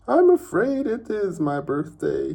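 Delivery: put-on high, loud, chuckling old man; bubbling over with giddy glee; giggly old uncle. Uncle Albert answering while floating.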